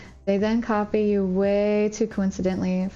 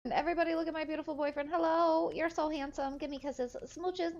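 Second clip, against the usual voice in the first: cooing; 6 semitones higher, 10 dB quieter than usual. Real, not acted. Cooing at her boyfriend.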